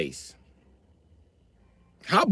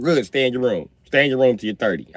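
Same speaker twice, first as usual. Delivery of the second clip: normal talking voice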